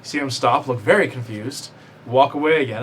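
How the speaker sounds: ordinary talk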